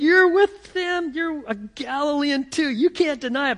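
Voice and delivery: nail him down, no escape; accusing bystanders. Acted. Bystanders accusing Peter a third time, high accusing.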